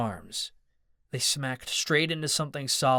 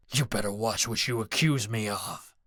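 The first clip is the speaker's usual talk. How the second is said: threatening; 2 semitones lower than usual. A narrator's reading. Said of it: "Mad Dog threatened".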